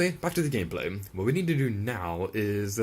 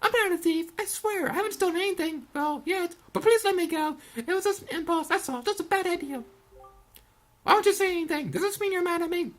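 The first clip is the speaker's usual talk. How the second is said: put-on high girlish voice, begging and defensive; high-voiced, anxious excuses; pleading girl thief. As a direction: plead your case as a caught young thief.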